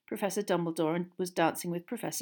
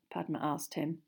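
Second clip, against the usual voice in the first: plain reading voice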